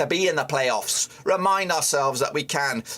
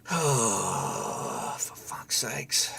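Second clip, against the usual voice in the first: lower, softer slow despairing groan; 7 semitones lower, 6 dB quieter, much slower than usual. Real, not acted. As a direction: let it sink out of you, defeated. Groaning despair at a missed chance.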